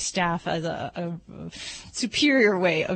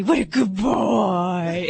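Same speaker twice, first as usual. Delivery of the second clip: put-on baby talk praise; 3 dB louder, much slower than usual. Vet showing how she praises her dog Boss, slow, loud baby talk. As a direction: gush over the dog slowly and loudly. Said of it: "there's certain things I'll say".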